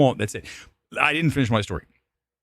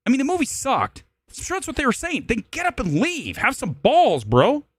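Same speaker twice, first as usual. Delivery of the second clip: high-pitched, worked-up scolding; exasperated rant; 10 semitones higher than usual. Acted. Moviegoer telling bored couple to leave, high exasperated rant. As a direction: keep ranting high at the offenders.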